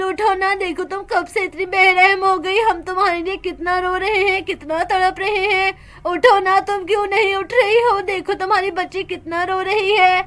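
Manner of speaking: ordinary talk, nothing marked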